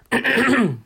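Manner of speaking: loud throat clear. Real, not acted. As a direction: clear a scratchy throat hard. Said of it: "my throat is playing up".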